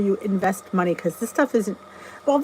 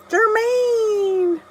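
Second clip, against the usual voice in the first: calling out; 12 semitones higher than usual. Real, not acted. Greeting a viewer by name.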